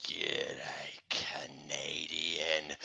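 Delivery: very low, hushed, dragged-out greeting; killer freight car. Acted. Possessed car creeping up on victim, soft deep slow voice.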